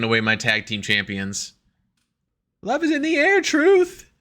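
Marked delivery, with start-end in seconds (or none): 2.6-4.1 very high, playful falsetto; mock lovey-dovey. One wrestler declaring love to Truth, mock lovey-dovey. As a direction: coo it in a silly high voice.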